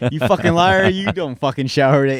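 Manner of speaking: loud, high scolding yell; angry girlfriend. Retold or imitated. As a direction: chew him out for lying. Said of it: "my girlfriend's gonna be like".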